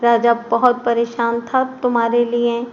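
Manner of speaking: ordinary delivery, nothing marked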